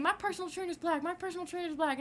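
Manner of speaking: high repeated mimic; mimicking the woman. Teen mimicking the mom's excuse, smug high voice. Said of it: "And she just kept saying".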